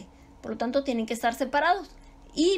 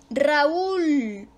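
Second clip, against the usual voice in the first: "Raúl" drawn out on the ú; teacher stretching "Raúl" to show its stress; stressed weak vowel; 2 semitones higher, 4 dB louder than usual. Shown as a demonstration. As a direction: lean long on the ú of "Raúl". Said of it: "la sílaba tónica cae en la débil".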